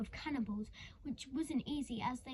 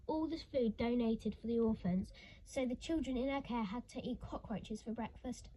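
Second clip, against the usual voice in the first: plain reading voice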